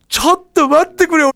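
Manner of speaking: high, loud cry of ちょっと待ってくれよ; overwhelmed protest. Retold or imitated. Surprised by all his friends, crying out loudly, overwhelmed. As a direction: blurt it out, caught completely off guard.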